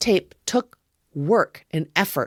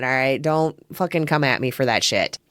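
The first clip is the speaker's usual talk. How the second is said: ordinary talk, nothing marked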